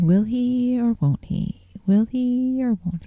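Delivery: playful mock-dramatic wondering; teasing suspense. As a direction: draw it out like a cliffhanger. Teasing suspense about opening box.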